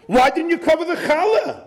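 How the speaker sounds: put-on high, yelling Yiddish-accented husband. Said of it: "when people start screaming and hollering".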